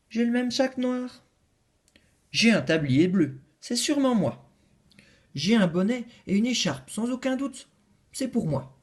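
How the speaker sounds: each insists it's for them; eager claims. Acted. Les animaux réclament la lettre, excités.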